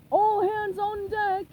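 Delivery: rally the crew like a captain; crying out. A narrator's reading. Mister Krabs rallies the crew, loud slow captain's call. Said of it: "Mister Krabs cried".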